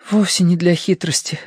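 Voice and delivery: usual speaking voice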